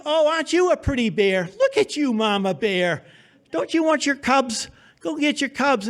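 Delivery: put-on high, sing-song baby talk to soothe her; baby talk to a bear. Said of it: "I had learned my baby talk with the moose".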